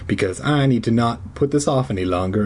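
usual speaking voice

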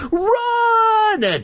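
very high, stretched-out mock shriek. Sister warns brother in the graveyard, hammed-up shriek. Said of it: "Terry shrieked".

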